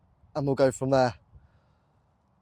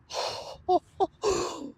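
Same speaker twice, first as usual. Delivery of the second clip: high sudden gasp of astonishment; sharp gasp; 6 dB quieter than usual. Real, not acted. Detectorist spots the ring in the mud, gasping high. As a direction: catch your breath at the sight.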